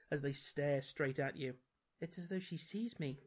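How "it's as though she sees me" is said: softly gasped in awe. A narrator's reading. Watcher at the crystal ball, gasping softly. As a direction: gasp it quietly, a little breathless. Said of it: "you gasp".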